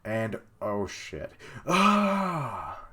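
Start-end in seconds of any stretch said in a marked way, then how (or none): 1.7-2.8 high startled cry; shocked yelp. Real, not acted. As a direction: gasp out a sharp cry. Reaction to the plunge.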